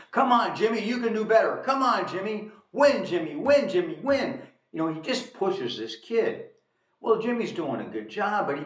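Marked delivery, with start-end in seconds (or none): 0.1-4.4 higher, louder insistent urging; pushy father. Acted. Domineering father pushing his son to win, urgent and raised. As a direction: egg him on like an overbearing dad. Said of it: "He pushes his son, he pushes him".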